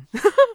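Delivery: squeaky burst of laughter; high laugh. Real, not acted. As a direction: shriek a quick laugh.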